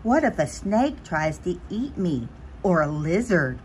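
ordinary delivery

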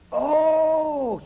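high, loud drawn-out oh in the retelling; loud, high astonished exclamation; amazed oh. Retold or imitated. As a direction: cry out in astonishment at the sight. Retold exclamation at bulldog.

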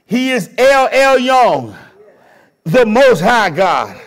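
full-voiced, measured preaching. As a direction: proclaim it from the pulpit, slow and strong. Preacher opening with scripture.

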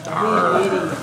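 short playful pirate arr; pirate growl. Acted. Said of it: "National Talk Like a Pirate Day".

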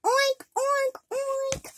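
high, playful oink, oink, oink like a piglet. Pretend. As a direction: squeal "Oink, oink, oink" like a happy little pig. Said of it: "I say".

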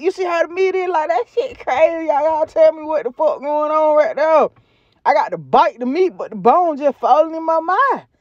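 high, disbelieving; amazed. Real, not acted. Food reviewer marvelling at the meat to viewers, amazed. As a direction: sound stunned by the meat.

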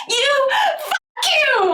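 hammed-up girl's cry. The girl yelling at the boy in translation, hammed-up crying yell. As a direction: drag it out like a sobbing tantrum. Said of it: "하면서".

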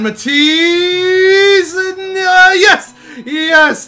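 very high, loud cry of joy; excited squeal. Real, not acted. Gamer thrilled at a lucky rebound, squealing high. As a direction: let your voice jump up in delight. Rebound tipped in their favour.

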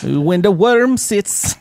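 slow, drawn-out Jamaican accent. Shown as a demonstration. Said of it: "Can you say it in a Jamaican accent?".

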